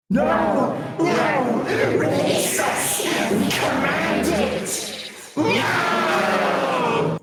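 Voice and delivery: high, shrieking demon wail; horrible demonic voice. Acted. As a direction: scream it like a dragged-back demon. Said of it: "in its horrible demonic voice".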